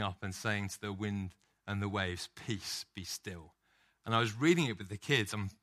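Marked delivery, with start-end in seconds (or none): 2.3-3.5 whispered peace, be still; whispered, calming the storm; hushed command. Acted. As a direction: breathe it out like a hush over the water. Jesus calms the storm, quoted.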